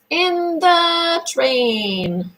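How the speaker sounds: drawn out, lifted and slow; slow sing-song. Shown as a demonstration. Therapist models the phrase for a child, slow and sing-song. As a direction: stretch every word for a little child.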